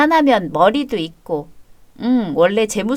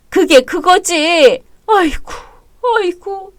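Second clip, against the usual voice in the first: wailing; 8 semitones higher, 3 dB louder, slower than usual. Acted. Mother wailing, high pitched.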